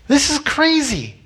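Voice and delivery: high-pitched cry of disbelief; mock panic. Peter doubting midway across the water, high mock-panicked cry. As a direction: let sudden doubt burst out high.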